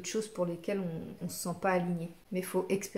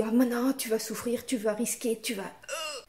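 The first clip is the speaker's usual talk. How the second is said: higher and louder, a pushy fearful voice; grosse voix de la peur; 4 semitones higher, 3 dB louder than usual. Shown as a demonstration. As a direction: play the ego scaring you off. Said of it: "t'as la grosse voix qui se ramène, qui te dit". (grosse voix qui fait peur)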